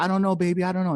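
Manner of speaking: retold scared mom. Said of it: "she starts getting scared".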